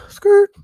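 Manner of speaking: high, loud tire-screech "skrrt"; sudden high screech like brakes; screeching halt. Acted. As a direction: burst out a squealed skrrt. Imitated screech on being called out.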